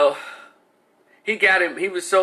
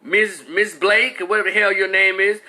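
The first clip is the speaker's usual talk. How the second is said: normal talking voice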